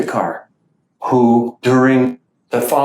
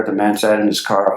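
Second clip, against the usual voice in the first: ordinary delivery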